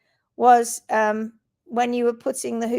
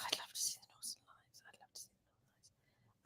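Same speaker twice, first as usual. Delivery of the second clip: barely audible whisper; faint whisper; 24 dB quieter than usual. Shown as a demonstration. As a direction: breathe it almost silently. Repeated in fading whisper.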